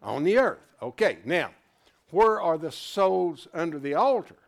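usual speaking voice

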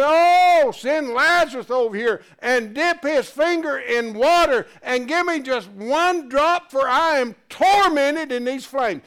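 high, loud, pleading cry from the flames; rich man's tormented cry. Acted. Rich man begging Abraham from hell, in a loud tormented plea. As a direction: beg for one drop of water like a man in torment. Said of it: "he screamed".

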